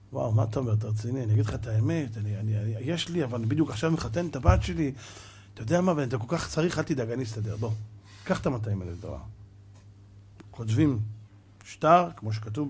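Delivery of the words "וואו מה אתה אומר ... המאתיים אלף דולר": much lower and softer, kindly reassuring; calm him down, no worries; gentle low-voiced friend; generous friend. Friend answers softly, generously.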